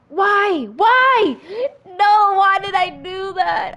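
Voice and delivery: loud, high wail of disbelief; anguished why. Real, not acted. Gamer failing the jump, loud high wail of disbelief. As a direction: cry out at your own mistake.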